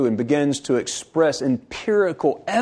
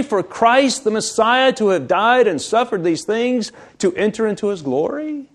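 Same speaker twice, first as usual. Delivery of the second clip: higher, pleading rebuke; Jesus rebuking; 5 semitones higher than usual. Acted. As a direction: chide them as a disappointed teacher. Jesus rebuking disciples, paraphrased.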